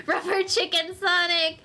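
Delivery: words breaking up with laughter; amused laughing. Real, not acted. Reader tickled by a yeeting Sonic, laughing. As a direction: giggle through the words.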